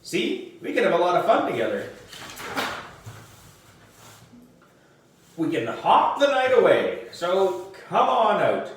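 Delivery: put-on boy coaxing his lost frog; coaxing frog talk. A narrator's reading. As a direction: lure a frog out with fun. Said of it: "Something like".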